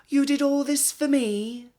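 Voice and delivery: put-on high, loud, overwhelmed old lady; Missus Rainbow, tearful. A narrator's reading. Overwhelmed old lady thanking the villagers, high and loud. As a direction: voice the moved old lady near tears. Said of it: "she asked".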